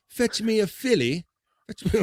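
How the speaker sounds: high, slow, mock British accent. Host mocking Canadians tossing coins, in a British accent. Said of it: "I did a British accent".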